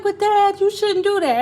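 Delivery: put-on high childlike plea; young daughter pleading. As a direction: plead with him like a little girl. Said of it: "I was like".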